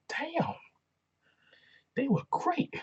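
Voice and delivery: high-pitched acted amazement; impressed reaction. Host recalls his reaction to the faction, impressed high voice. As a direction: replay being impressed, pitch way up.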